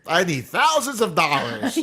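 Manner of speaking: high, laughing pretend demand; mock greedy ask. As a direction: joke a greedy request through a laugh. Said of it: "I was like".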